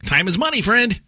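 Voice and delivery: high goblin voice on the phone; goblin greeting. Retold or imitated. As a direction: play a greedy goblin answering. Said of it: "'Cause I'll answer my phone at work".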